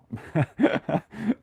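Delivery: high chuckle; real high giggle; amused laugh. Real, not acted. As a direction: laugh at a cheeky remark. Laughs at viewer's cheeky remark.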